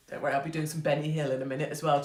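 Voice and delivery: usual speaking voice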